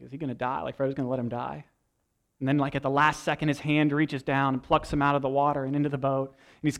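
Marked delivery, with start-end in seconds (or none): 0.0-1.6 softer, fast anxious question; hushed audience worry; mock nervous moviegoer; worried viewer. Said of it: "and you're like".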